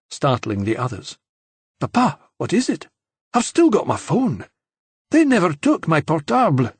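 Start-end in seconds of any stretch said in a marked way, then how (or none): 1.8-6.7 a touch of excited surprise. A narrator's reading. Sophie reacts to the phone.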